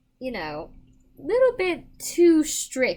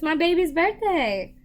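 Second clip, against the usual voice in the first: happy; 6 semitones higher, 5 dB louder than usual. Real, not acted. Repeats baby's birthday joyfully.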